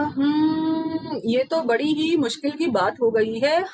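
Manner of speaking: long hmm then a sly laughing line; mischievous schoolboy chuckle; chuckling. Acted. Ram tells Shyam he'll fake again, sly chuckle.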